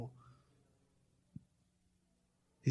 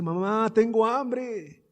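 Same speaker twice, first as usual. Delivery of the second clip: put-on a hungry girl's plea, slow and higher; imitated tearful child; 10 semitones higher, 7 dB louder, much slower than usual. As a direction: plead to mother, drawn out and rising in pitch. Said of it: "La niña empieza a llorar y le dice".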